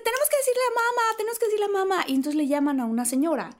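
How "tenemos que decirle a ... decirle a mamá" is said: high, fast eager chatter; girlish mock eagerness; excited girls. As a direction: rush to tell mom. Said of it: "entonces dice".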